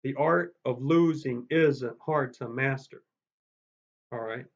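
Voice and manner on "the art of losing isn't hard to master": slow, every stressed beat hammered; artificially accented meter. Shown as a demonstration. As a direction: read it with heavy sing-song meter stress. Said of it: "I'm gonna read it artificially aloud".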